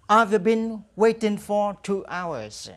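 very slow, clear English answer; slow drill model. Shown as a demonstration. Teacher drills the answer slowly for students to repeat. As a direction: stretch every word for learners to copy. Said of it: "Now repeat after me".

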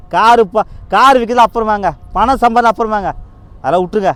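loud, high-pitched urgent appeal; impassioned plea. Real, not acted. Vlogger urging viewers to put parents before money, impassioned and loud. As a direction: raise the voice and plead insistently.

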